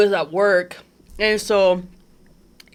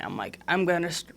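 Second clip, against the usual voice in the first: choked up; 3 semitones lower, 8 dB quieter, faster than usual. Real, not acted. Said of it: "Take your time".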